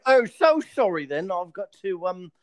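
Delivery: politely apologetic English Batman; cheery English manners while beating someone; British accent. Pretend. British Batman apologising to a thug he's beating. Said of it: "if Batman was British".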